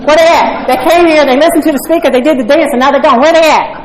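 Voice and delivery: imitating my old impatient self; indignant complaining. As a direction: gripe at the empty room, half-mocking yourself. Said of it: "I'd say".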